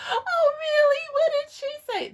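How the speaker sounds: very high, loud mock innocence; fake-innocent mother. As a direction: play dumb in a squeaky put-on voice.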